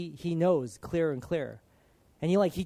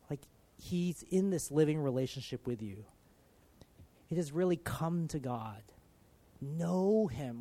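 ordinary delivery

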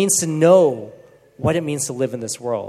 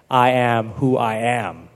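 solemn divine voice; 4 semitones lower, slower than usual. Retold or imitated. God introducing himself, quoted.

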